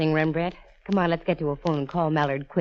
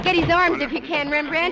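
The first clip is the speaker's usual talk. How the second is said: urgent call; 11 semitones higher than usual. Acted. Struggling with the owner.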